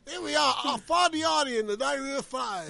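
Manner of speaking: high, loud stage shout; mock rock singer. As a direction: belt nonsense like a rocker. Second host playing rocker on stage.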